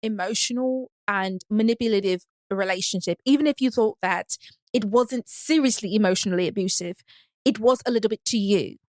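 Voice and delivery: put-on measured London accent with careful pauses. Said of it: "my London accent low-key good".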